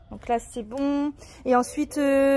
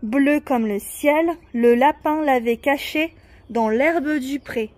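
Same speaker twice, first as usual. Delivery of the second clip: put-on slow, even recital of a children's poem; poem reading; 4 dB louder, slower than usual. Shown as a demonstration. Mother rereading the poem to her daughter, slow recital. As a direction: read it line by line, slowly. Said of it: "on avait dit quoi?".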